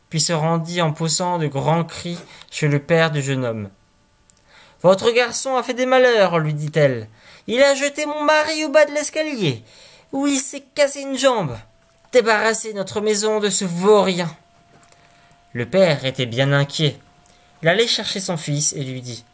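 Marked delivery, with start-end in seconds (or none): 4.8-14.3 much higher, shrill-voiced woman complaining; outraged wife. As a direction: demand he throw out the rascal.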